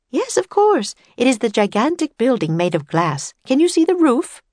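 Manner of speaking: high, emphatic woman's voice; busy exhibit woman. A narrator's reading. Woman pointing out the glass building, high emphatic voice.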